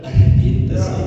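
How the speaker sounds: loud, drawn-out call to repent; urgent exhortation. Retold or imitated. Nephew urging his uncle to repent, loud and insistent. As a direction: stretch the command out forcefully.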